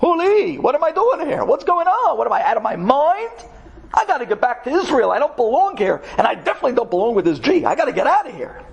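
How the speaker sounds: a man waking up to his mistake; fed-up inner voice. Retold or imitated. Israeli realizing he must go home, high and exasperated. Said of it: "you realize".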